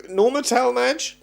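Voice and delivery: high, loud hesitant guess; unsure guess. Retold or imitated. Retelling his own nervous guess at the film star, high and loud.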